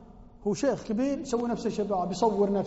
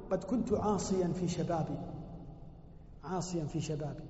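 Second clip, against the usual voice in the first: humble confession; 5 semitones lower, 8 dB quieter than usual. Retold or imitated. Malik ibn Dinar confessing his youth, softly. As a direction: confess it quietly. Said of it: "مالك بن دينار يقول".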